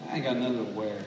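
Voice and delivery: low, soft, mock-whiny moan; mock whine. Preacher mimicking a closet full of clothes, low mock whine. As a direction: sulk it out under your breath. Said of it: "you're like".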